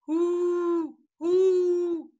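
high hooting owl voice. Shown as a demonstration. Grandpa hoots for the kids, high owl call. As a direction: hoot each one high and held. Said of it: "What does an owl say?".

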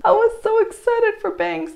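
high, loud emphatic lament; rueful excitement. Real, not acted. Lamenting the result.

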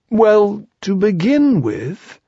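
high, slow bear voice; high drawn-out bear; Pooh. A narrator's reading. Pooh breaks in again.

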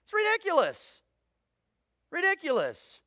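high-pitched, incredulous scoff; scoffing dismissal. Real, not acted. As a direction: toss it off as utterly absurd. Scoffs at castle's complaint.

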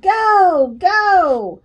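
loud, high cheering; yelling. A narrator's reading. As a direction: urge the rider on with long calls. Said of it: "I yelled".

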